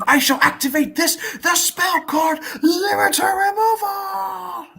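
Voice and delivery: high, slow, shouted card call; hammy anime duelist. Acted. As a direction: belt it like a card game villain. Anime duel card activation.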